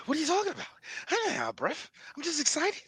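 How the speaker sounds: high, soft out-of-breath actor impression; Mark Wahlberg. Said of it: "sound like you out of breath".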